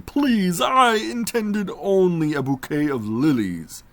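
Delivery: high, slow, loud shout of the magician; desperate shout. A narrator's reading. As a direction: cry it out to the lantern light, slow and loud. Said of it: "shouted the magician".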